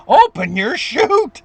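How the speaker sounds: high, drawn-out cry of alarm; mock panicked yell. Jumper's last warning before impact, hammed-up panicked yell. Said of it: "he yells down at him one more time to total panic".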